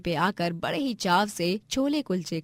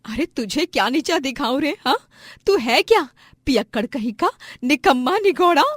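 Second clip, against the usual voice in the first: higher, louder angry retort; scornful scolding; 5 semitones higher, 6 dB louder than usual. Acted. Wife answers husband's threat, loudly scornful. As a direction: hurl insults back.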